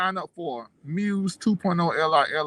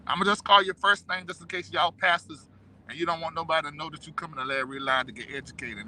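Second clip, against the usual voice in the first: normal talking voice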